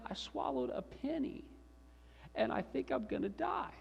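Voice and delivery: put-on high, soft small-child voice; whimpering little boy. Little Sammy confesses to Dad, whimpering. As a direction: imitate a frightened three-year-old, small and high. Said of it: "Little three-year-old".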